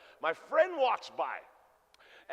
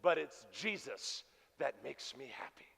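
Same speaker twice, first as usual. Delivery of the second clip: much softer, lower and slower secret; hushed confiding; 6 semitones lower, 8 dB quieter, slower than usual. Retold or imitated. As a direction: lean in and confide it softly. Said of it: "she leans in".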